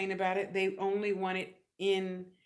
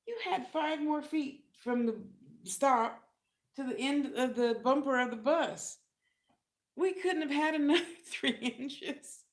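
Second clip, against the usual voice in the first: exasperated, higher voice with a laugh; higher mock complaint; 8 semitones higher than usual. Complaining to herself about the cramped toilet, higher. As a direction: gripe about the tiny bus bathroom. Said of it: "And I'm like".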